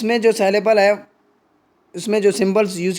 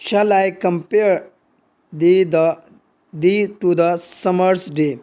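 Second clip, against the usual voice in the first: compare read with the weight on pair, very slow; slow recitation; much slower than usual. Shown as a demonstration. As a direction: recite it slowly, leaning on the beat of compare. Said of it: "यहाँ पर word compare".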